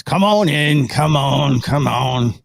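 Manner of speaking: lightly voiced hooded giant waving them in; a hint of big figure voice; deep-voiced figure. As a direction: beckon them in, in character. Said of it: "very deep voice".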